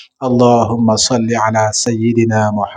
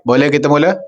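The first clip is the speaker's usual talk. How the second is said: ordinary talk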